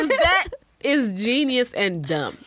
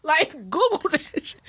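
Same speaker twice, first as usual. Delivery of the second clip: mock exasperated yell; 10 semitones higher, faster than usual. Mom retells yelling at her son's silly question, hammed-up. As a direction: snap back like a fed-up mom, hammed up. Retold exasperated reply to son.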